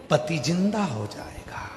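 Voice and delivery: soft, falling end to the promise. Acted. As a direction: say it quietly, letting it drop. Guru's promise, first take. (धीमे स्वर में)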